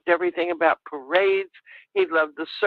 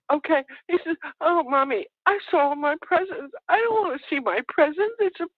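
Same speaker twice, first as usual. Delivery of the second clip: higher, lightly voiced tearful child; imitating upset boy; 9 semitones higher than usual. Mother voicing her son upset about presents, higher and tearful. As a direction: carry on like a distraught kid. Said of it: "he carried on something fierce".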